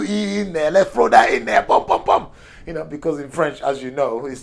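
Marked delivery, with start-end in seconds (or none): 1.6-2.3 high bum bum bum. Retold or imitated. Mock fanfare dropping letters in.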